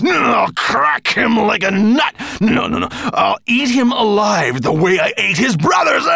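loud snarled threats, mostly high; furious snarl. A narrator's reading. Nettlebrand at the desert sands, snarling in rage. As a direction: rage on, savouring each threat. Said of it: "he snarled at the desert sands".

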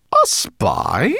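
high, incredulous question; disbelieving. A narrator's reading. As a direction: doubt every word of it. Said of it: "asked the rat disbelievingly".

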